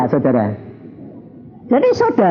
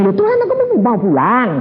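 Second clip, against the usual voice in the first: pleading to go home; 8 semitones higher than usual. Retold or imitated. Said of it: "Aku bilang".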